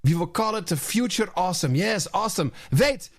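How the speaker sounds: gleeful put-on European executive accent; hammed-up European accent, pleased with himself; mock European accent. Host plays the douchebags brainstorming a jingle, mock European accent. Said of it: "Your accent is great".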